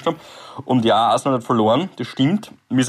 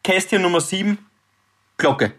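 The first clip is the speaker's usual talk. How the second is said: slow suspense reveal; 6 semitones higher, slower than usual. Acted.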